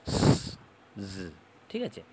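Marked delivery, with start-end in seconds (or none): 0.1-0.6 "স" as a long unvoiced hiss; voiceless s demo. Shown as a demonstration. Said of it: "এটা সাউন্ডটা কীরকম হবে". 1.1-1.1 "জ" as a short buzzed English z; isolated buzzing "জ", sounded as z; voiced z demo. Shown as a demonstration. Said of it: "যেটা হচ্ছে এস আর জেড যেটা".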